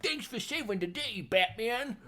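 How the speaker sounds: high, hammed-up commissioner voice; silly commissioner comment. Host mocking the commissioner thanking Batman, silly high voice. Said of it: "just there to, like, make silly comments".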